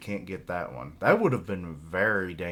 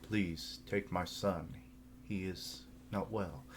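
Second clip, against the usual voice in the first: soft, subdued meme imitation; mock solemn plea; 9 dB quieter than usual. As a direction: plead softly, imitating a meme. Quotes meme plea, contrasting earlier shout.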